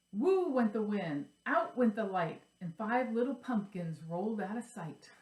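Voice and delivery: lively sing-song read-aloud; reading a children's rhyme. Acted. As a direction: read it like to little kids. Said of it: "I'm gonna read this".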